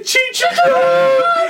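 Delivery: high, delighted shriek of joy; a little girl shrieking over pork rinds; excited squeal. Retold or imitated. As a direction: squeal it like finding treasure. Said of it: "She's like".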